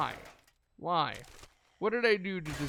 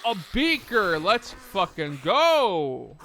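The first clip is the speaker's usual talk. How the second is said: higher, louder, slow triumphant cheer; celebratory cheer; 4 semitones higher, 9 dB louder, much slower than usual. Real, not acted. Player celebrating a beaker find, loud slow cheer. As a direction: cheer it out over the loot.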